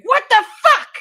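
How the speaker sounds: re-enacted shout, high and very loud; sudden loud, high-pitched yell; sleep-talk outburst. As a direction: burst out swearing as if jolting awake. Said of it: "I will wake myself up by saying".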